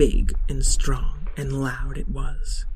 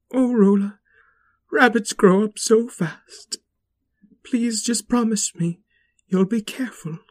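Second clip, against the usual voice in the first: higher, pleading mother rabbit; 8 semitones higher, 4 dB louder than usual. Acted. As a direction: beg her child to be careful.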